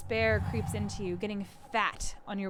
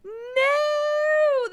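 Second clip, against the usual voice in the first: put-on high, loud kid scream; 8 dB louder than usual. The boy screaming in fake outrage, high scream. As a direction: scream it out in mock horror. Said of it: "the boy screams".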